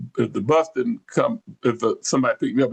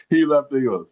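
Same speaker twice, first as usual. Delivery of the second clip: ordinary talk, nothing marked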